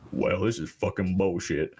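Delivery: low, slow gruff grumble of a TV host; deep-voiced Mike Rowe complaining at a paintball field. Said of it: "in his deep ass voice".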